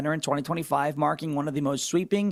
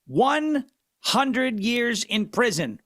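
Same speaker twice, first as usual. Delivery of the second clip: one hundred years dragged out slowly and higher; slow emphatic delivery; 5 semitones higher, much slower than usual. Real, not acted. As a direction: savour each word slowly, pitched up. Savouring the sentence.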